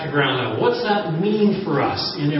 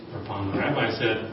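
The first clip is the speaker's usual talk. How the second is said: ordinary talk, nothing marked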